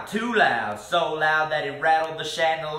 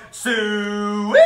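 long, loud soo-ee rising to a squeal; hog call; 5 dB louder than usual. A narrator's reading. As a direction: call the hogs across the fairground. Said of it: "But when he called".

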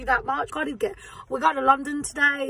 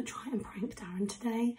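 whispering; 9 dB quieter than usual. Real, not acted. Secret prank setup to camera.

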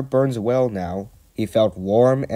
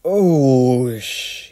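loud, high whoosh ending in a hiss; drawn-out "oosh" hiss; hissing steam; 4 semitones higher, 6 dB louder than usual. A narrator's reading. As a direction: imitate steam as water hits the fire. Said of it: "he hissed".

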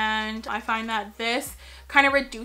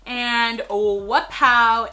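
excited; 8 dB louder, much slower than usual. Real, not acted. Excited reaction to opening box.

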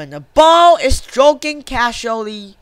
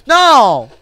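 loud, higher cry of no; dismayed shout; 4 semitones higher, 8 dB louder than usual. Real, not acted. Player whose tactics were guessed, dismayed shout. Batter foils the player's plan.

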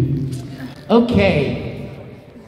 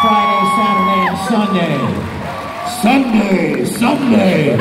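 monster truck announcer; 3 semitones higher, 4 dB louder than usual. Pretend. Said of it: "Now it just turned into a monster truck commercial".

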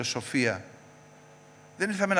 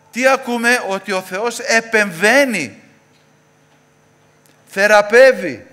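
loud, high, ringing call-and-answer sermon; full-force preaching; 8 semitones higher, 11 dB louder than usual. Real, not acted. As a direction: drive each answer home, louder and drawn out. (δυνατό, φλογερό κήρυγμα)

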